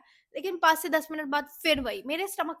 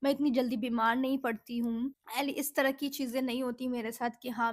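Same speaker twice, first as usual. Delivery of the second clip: ordinary delivery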